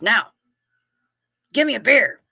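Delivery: the bear now snarling for a beer; angry bear. Acted. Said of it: "looks at the bartender and says".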